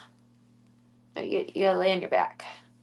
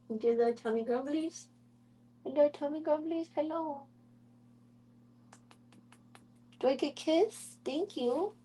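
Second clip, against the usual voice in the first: higher, softer cooing; high soft baby talk; 7 semitones higher, 6 dB quieter than usual. Acted. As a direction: coo tenderly at the kitten's tummy. Cooing at kitten's tummy.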